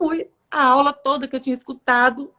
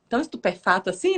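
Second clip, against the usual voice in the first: ordinary talk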